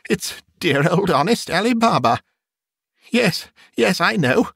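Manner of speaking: high-pitched, eager delight; ecstatic excitement. A narrator's reading. Scrooge relives a lonely boyhood Christmas, ecstatic. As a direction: gush with rising wonder at an old friend. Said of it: "Scrooge exclaimed in ecstasy".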